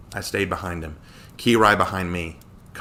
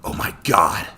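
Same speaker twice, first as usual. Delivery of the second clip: whispered gasp of shock; gasped awe; 2 semitones lower than usual. A narrator's reading. Connor sees the rock melt away, gasped awe. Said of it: "Connor gasped".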